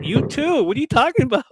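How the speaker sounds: high, loud playful comeback; excited retort. Retold or imitated. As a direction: fire it back, laughing. Retort to uncle.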